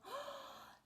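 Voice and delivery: sharp high gasp of delight; high squeaky gasp; excited gasp. Acted. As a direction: gasp like a thrilled squirrel.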